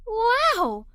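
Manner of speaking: plain reading voice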